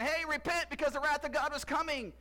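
high, loud call to repent; mock prophet call. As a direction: preach it like a wild prophet. John the Baptist calling out.

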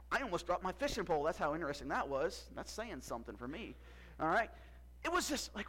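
ordinary talk

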